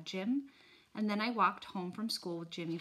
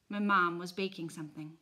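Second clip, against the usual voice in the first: ordinary delivery, nothing marked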